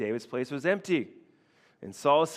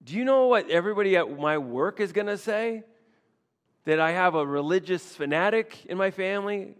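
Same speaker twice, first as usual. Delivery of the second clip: higher, louder exasperated relative; family objecting; 4 semitones higher, 4 dB louder than usual. Retold or imitated. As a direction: scold the new believer. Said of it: "they're like".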